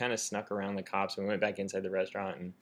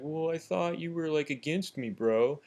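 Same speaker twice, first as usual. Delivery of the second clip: higher-pitched, mildly whiny excuse; lightly imitated defensive bro; 6 semitones higher than usual. Puncher answering the man he hit, mildly defensive imitation. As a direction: play a puncher lamely justifying himself.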